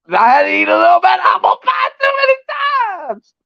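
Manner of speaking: high, fast shouted mock rant; mock shouted Brandon. The streamer mocks Brandon admitting defeat, in a high, fast mock shout. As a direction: yell it like a friend caught gloating. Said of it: "He's like".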